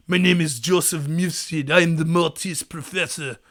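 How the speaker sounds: low, soft Bond villain with a badly faked French accent; mock French accent, low and hushed. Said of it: "Why am I doing a shitty French accent?".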